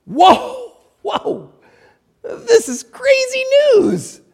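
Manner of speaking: high-pitched, hammed-up astonishment; amazed women. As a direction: play stunned disbelief, stretching the words out high. Acting the women's amazed reaction.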